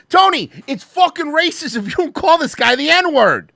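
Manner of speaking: loud, high, hammed-up scolding; mock outraged yell. Egging Tony on to say the word, mock outraged yell. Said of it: "remember that?".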